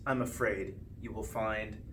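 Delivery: ordinary delivery, nothing marked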